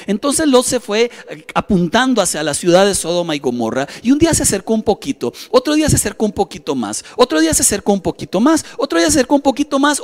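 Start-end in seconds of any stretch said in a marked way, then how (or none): 4.0-10.0 quick refrain climbing higher step by step; each repeat more pressing, pitch rising to the last; building repetition. Real, not acted. Step-by-step approach built by repetition. (repetición rápida que va subiendo de tono)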